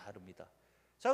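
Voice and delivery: ordinary delivery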